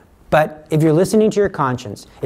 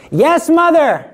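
high, loud eager reply; eager answer; 13 semitones higher, 5 dB louder, slower than usual. Retold or imitated. Jesus answering his mother, high and eager. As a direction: answer your mother right away.

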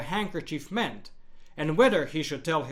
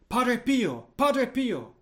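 higher-pitched but restrained mock shout; narrated mild shout; 6 semitones higher, slower than usual. A narrator's reading. Woman spotting the saint on the handkerchief, mildly shouting. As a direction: call out high without real volume. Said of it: "she started shouting".

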